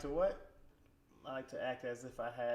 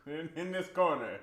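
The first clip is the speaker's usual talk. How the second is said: mock boxing announcer, a bit higher; ring announcer; 4 semitones higher, 4 dB louder than usual. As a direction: introduce a fighter to the crowd.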